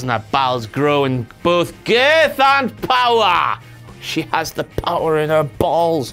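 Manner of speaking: low booming monster voice. Acted. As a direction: boom it like a monster. Monster voice for power.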